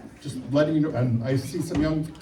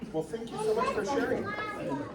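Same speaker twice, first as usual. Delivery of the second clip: sweet thanks; 7 dB quieter than usual. Real, not acted. Thanking a child for sharing.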